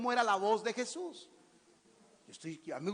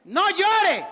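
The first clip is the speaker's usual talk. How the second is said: loud, high commanding shout; hard-hitting yell; forceful; 10 semitones higher, 12 dB louder than usual. Shown as a demonstration. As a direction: say it strong, as an order. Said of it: "pero fuerte".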